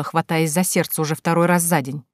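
usual speaking voice

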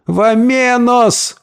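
high, drawn-out imploring cry; pleading call. A narrator's reading. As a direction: call after someone, begging. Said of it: "умоляюще крикнул вдогонку Гомес". (протяжный умоляющий оклик)

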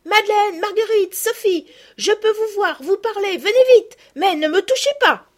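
put-on girl calls her friends from afar; calling out. A narrator's reading. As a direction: shout to her friends with joy. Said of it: "en criant".

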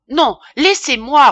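loud, high, exasperated refusal; angry protest. A narrator's reading. Apothecary tells his family to let go, exasperated. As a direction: shake them off furiously.

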